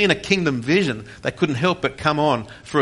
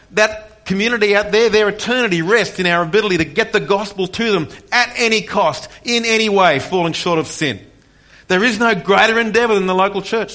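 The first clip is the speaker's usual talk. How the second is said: a raised, ringing preacher's voice, building; fervent preaching; 6 semitones higher, 3 dB louder than usual. Real, not acted. Pastor urging his church about the gospel, fervent preaching. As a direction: preach it out to the congregation with full conviction.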